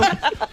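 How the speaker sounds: quick high burst of laughter; short laugh. Real, not acted. Laugh amid argument.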